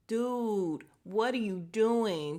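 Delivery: loud, drawn-out disbelief; exasperated. Retold or imitated. Viewer calling out Sax for lying, exasperated. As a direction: stretch each word in loud exasperation.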